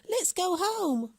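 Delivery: put-on high, slow cheerful child; happy boy voice. A narrator's reading. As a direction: lead her home gladly. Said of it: "laughed Hansel".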